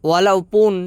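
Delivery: ওয়ালাউ পুন demonstrated with emphasis; Malay word walaupun. Shown as a demonstration. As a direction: sound out ওয়ালাউ পুন as wa-lau-pun. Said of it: "যেমন".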